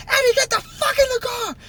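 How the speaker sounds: high, shrieked retold order from the manager; mock frantic yell from the car. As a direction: scream at him to get in the car.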